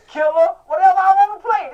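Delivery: loud proud shout, rising high; shouted boast. Pretend. Showman to his fans, loud proud shout. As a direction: belt it out like a heel on stage. Building to proclaim his stage.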